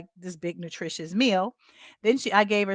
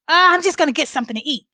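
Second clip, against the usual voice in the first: rushed and a little louder, caught out; guilty hurry; 8 semitones higher, 10 dB louder, much faster than usual. Retold or imitated. Mother caught in the kitchen, rushing her excuse to her daughter. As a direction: blurt it out fast like a kid caught sneaking food. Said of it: "the way in which she said it".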